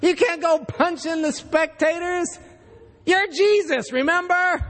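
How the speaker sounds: high, scolding boss voice, pitched well up; a fed-up director chewing out his actor; exasperated director. Retold or imitated. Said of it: "the director pulls this guy in and says".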